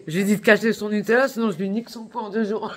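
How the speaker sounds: loud, high, laughing through the words; loud and high, cracking up mid-sentence. Real, not acted. Joking threat, laughing.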